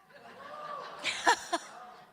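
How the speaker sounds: high, breathy burst of laughter. Real, not acted. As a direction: laugh out at your own story. Amused at kimono fitting her.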